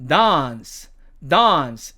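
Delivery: British "dance" with long ah; British demo of "dance"; British accent. Shown as a demonstration. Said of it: "영국식으로는".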